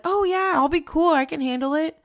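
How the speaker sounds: mock-carefree past self; breezy self-quote. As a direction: toss it off like it's no big deal.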